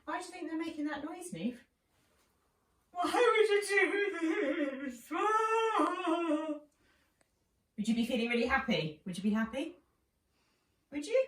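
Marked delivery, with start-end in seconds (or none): 2.9-6.5 high, loud, slow keening cry; wailing mourner. Acted. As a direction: wail it like a grieving mourner. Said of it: "people in the house who were wailing".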